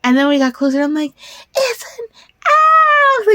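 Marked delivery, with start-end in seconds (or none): 1.5-3.3 very high, tearful squeal of delight; overjoyed crying squeal. Retold or imitated. Relived on the highway, spotting the owl, high tearful squeal. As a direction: shriek it out, near tears at finally seeing the owl. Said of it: "I was crying like an idiot".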